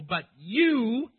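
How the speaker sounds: "you" drawn out and very loud; emphatic YOU. Acted. Preacher reads Moses confronting God, heavy stress on "you". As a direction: hit "you" hard and stretch it. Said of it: "it's emphatic in the Hebrew".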